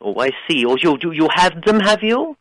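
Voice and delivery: ordinary delivery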